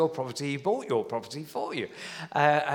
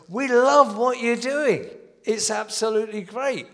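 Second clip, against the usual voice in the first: council praise; 7 semitones higher, 7 dB louder than usual. Retold or imitated. Council officials praising the church, gushing and loud. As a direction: lay the praise on thick.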